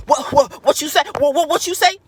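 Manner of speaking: hammed-up combative comeback; confrontational type. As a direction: bristle as if ready to fight. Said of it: "personality type that's like".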